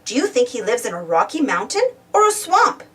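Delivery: ordinary delivery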